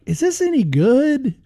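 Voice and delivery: high, skeptical question in a lightly put-on voice; doubtful. Record buyer in the shop, wondering aloud, mildly skeptical. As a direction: wonder if a cheap old record is any good. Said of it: "you're like".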